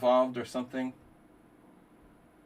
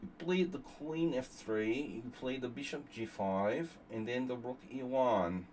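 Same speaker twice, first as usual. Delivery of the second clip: mock chess doctor. Streamer playing Doctor Nef Idov explaining moves in a mock lecture voice. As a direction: explain the moves like a pompous professor. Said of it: "Doctor Nef Idov".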